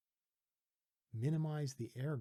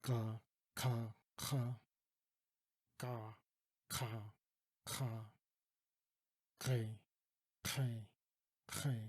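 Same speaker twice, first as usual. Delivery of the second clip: varied speed; slower than usual. Shown as a demonstration. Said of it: "I can play with the speed".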